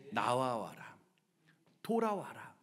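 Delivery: softly beckoning; gentle call. Acted. God calls sinners to come back, gentle call. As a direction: call them back quietly, unhurried.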